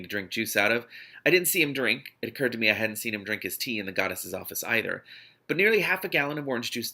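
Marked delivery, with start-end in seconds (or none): none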